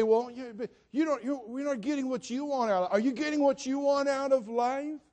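high-pitched imitation of a nagging objector; mock challenge. People questioning a devoted mom, mock nagging. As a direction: push it high and insistent, imitating a doubter.

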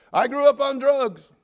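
loud, high, drawn-out testimony opener. Retold or imitated. College kid giving his testimony to the church, loud and declamatory. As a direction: belt out a dramatic confession. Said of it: "gave his testimony. He said".

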